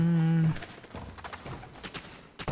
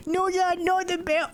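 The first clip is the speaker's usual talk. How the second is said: put-on very high squeaky kid plea; pleading child. Child begging Dad not to use the belt, squeaky.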